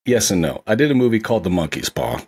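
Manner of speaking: ordinary talk, nothing marked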